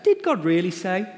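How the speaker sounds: mocking serpent. Preacher repeats serpent's question.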